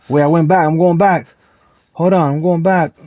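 loud, rushed and flustered; panicked. Real, not acted. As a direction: backpedal in a real panic. Panicking after getting lost.

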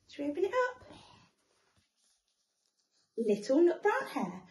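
plain reading voice

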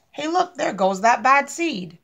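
lightly voiced teasing onlooker; a hint of a mocking bystander; passerby taunt. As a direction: call it out like a nosy kid.

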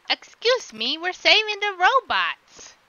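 loud, high, drawn-out protest; mock indignant. Player correcting the game character about the robots, mock indignant.

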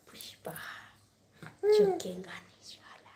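whispered Pushpa catchphrase, unvoiced; whispered movie dialogue for a little child; whispered film line. Shown as a demonstration. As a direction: breathe the dialogue softly like a secret. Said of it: "करून दाखव".

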